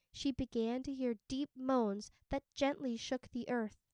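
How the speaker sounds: ordinary delivery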